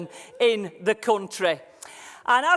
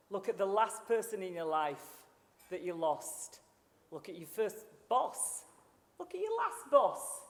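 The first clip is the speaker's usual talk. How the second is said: gentle, quieter prompts for the audience to remember; soft guided reflection; 4 dB quieter than usual. Acted. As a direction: lead them softly through their memories. Guides audience through memories.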